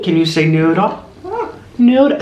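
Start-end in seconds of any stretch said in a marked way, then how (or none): 1.8-2.2 squeaky high call; high parrot call. Shown as a demonstration. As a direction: model the word for the bird. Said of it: "you have to enunciate".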